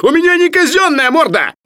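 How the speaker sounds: very high, loud screech; indignant squeal. A narrator's reading. Sharikov protests being slapped by the lady, squealing. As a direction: shriek it like the injured party. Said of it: "взвизгнул Шариков".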